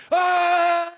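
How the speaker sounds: a sudden high yelping shout as the startled dog. Retold or imitated. Owner imitating his dog startled by a game dog, high yelp.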